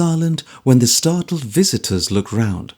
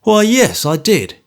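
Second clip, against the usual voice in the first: offhand; 8 semitones higher, 5 dB louder than usual. Acted. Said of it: "in his offhand way".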